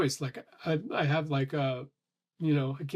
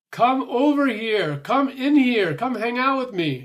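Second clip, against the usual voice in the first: high, loud, slow call to the cat; calling out to a cat in the other room; 8 semitones higher, 6 dB louder, slower than usual. Retold or imitated. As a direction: coax the cat over, sing-song and loud.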